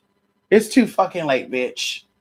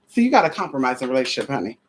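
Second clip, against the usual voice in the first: ordinary talk, nothing marked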